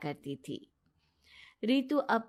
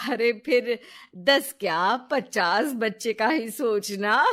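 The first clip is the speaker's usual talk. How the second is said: louder, mocking jealousy; envious taunt at her friend; jealous; 6 dB louder than usual. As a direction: needle her out of envy. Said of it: "रिया की बात से जलन होती है".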